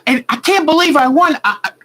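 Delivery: high, breathless stammering joy; giddy stunned winner; flustered disbelief. Retold or imitated. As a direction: stammer it out, can't believe you won. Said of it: "she got all flustered".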